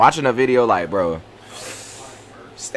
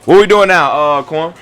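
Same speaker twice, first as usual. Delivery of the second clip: loud call to the guy on the video, Quan; loud, eager call asking what comes next; 9 dB louder than usual. Real, not acted. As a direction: yell the question across to the video. Calling out to Quan.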